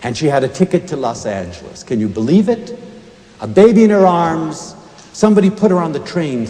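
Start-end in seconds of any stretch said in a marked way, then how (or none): none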